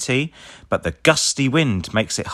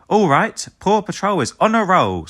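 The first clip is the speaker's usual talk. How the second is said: higher, louder team rally cry; rallying call; 10 semitones higher, 4 dB louder than usual. A narrator's reading. Ryder rallying the pups, higher and louder. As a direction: fire up the pups like a leader. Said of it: "says Ryder".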